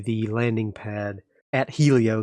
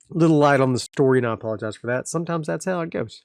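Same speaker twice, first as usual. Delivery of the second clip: bright, raised sign-off to listeners; upbeat outro; 3 semitones higher, faster than usual. Real, not acted. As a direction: wrap up the episode cheerfully.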